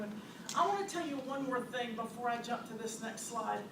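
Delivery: ordinary talk, nothing marked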